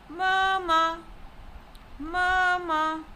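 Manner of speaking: "mama" with both syllables held high and level. Shown as a demonstration. Teacher showing the word for mother, "mama" in first tone. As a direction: keep "mama" on one steady note. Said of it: "high level tone".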